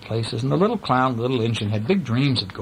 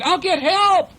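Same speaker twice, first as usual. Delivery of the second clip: high, loud promise called out; urgent call; 5 dB louder, slower than usual. A narrator's reading. Little blue engine to runaway clown, urgent high call. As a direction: call out high to a friend being carried off.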